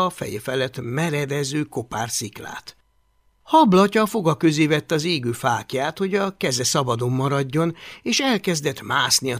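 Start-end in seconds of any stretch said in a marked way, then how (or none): none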